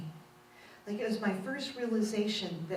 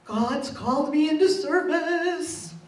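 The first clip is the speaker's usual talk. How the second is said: slow, higher and louder, almost sung; awed declaration; 7 semitones higher, 7 dB louder than usual. Retold or imitated. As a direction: announce it like a calling, overcome. Tearful announcement to partner.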